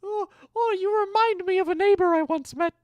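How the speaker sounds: very high squeaky cooing; squeaky baby talk. Pretend. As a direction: squeal it like fussing over a puppy.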